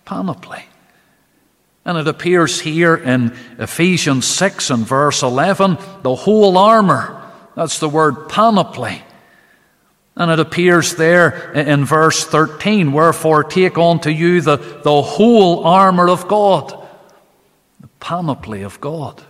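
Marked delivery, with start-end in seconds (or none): 0.1-0.6 panoply repeated, dropped much softer. Shown as a demonstration. Preacher repeating the Greek word, panoply echoed softly. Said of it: "it is that word". 1.8-16.7 building, louder pulpit preaching. Acted. Preacher stressing the whole armor of God, building pulpit preaching. Rising sermon intensity.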